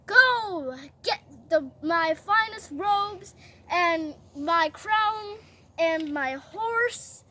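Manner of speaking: slow, emphatic decree, word by word; royal command. Acted. King ordering Haman to honor Mordecai, slow decree. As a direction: hand down a drawn-out order.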